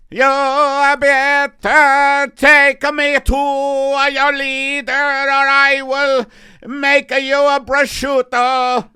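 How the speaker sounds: put-on high, slow alien with Italian accent; loud, drawn-out robotic Italian alien. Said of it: "programmed in an Italian alien voice".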